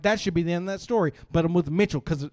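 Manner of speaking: usual speaking voice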